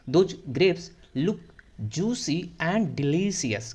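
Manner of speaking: very slow, word by word; slow model reading. Shown as a demonstration. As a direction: spell the line out slowly for students. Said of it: "teacher reads fox's line". (बहुत धीमे पढ़ना)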